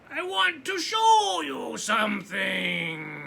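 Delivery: loud, slow, eerie calling; a creaky, far-away ghostly voice; creaky, cold, distant voice. Acted. The dark beckoning Laszlo with a slow, eerie call. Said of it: "voice of the dark was as creaky as the roof".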